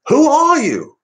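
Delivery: very high and loud Farage yell; Farage shout. Retold or imitated. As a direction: bark it like Farage in full cry. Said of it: "he's got the throaty".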